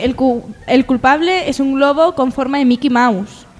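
ordinary talk

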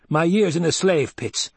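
heated, rasping retort; rasped grievance. A narrator's reading. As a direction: rasp it out with rising bitterness. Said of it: "Gurney rasped".

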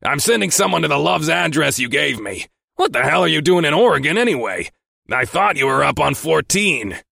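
loud, high, irritated boss; snapping. A narrator's reading. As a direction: scold your driver impatiently. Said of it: "he snapped".

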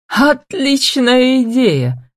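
high, drawn-out exclamation of approval; amused delight. A narrator's reading. Man delighted by the woman's marriage scheme, gleefully. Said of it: "расхохотался Марк".

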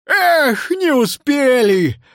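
high, drawn-out lament; rueful cry, slowly falling; sorrowful exclamation. Acted. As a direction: wail that it is all lost. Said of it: "горестно воскликнул Нил Нилыч".

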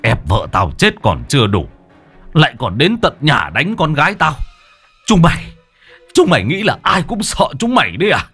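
angry snarl climbing high; growled anger. A narrator's reading. Father defending his beaten daughter, growled anger rising. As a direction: grind the words out, building to high outrage. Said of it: "Ông Toàn gằn giọng".

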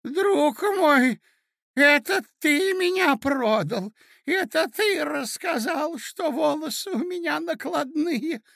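very high, slow, wounded wail; reproachful old prince. Acted. Prince blaming his nephew for betraying him, reproachful. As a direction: accuse him in a piping old voice.

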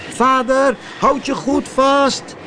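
high puppet voice calling across the sea; calling out to father. A narrator's reading. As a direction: shout encouragement to the drowning father. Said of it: "riep Pinocchio".

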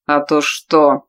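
slow sneering taunt; hissed through teeth. A narrator's reading. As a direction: grind it out spitefully. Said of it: "злобно процедил он".

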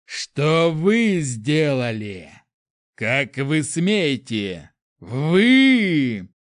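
loud, slow, drawn-out yelling; hate-filled bellowing. A narrator's reading. Prince roaring at the mob, hate-filled bellow. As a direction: bellow with seething hatred. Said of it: "Он так орал".

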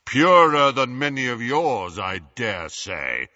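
slow deep booming voice; deep commanding. A narrator's reading. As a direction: proclaim it with weight. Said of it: "his deep voice echoed impressively".

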